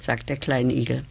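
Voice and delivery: plain reading voice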